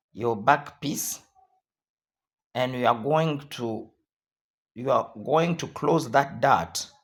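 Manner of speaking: normal talking voice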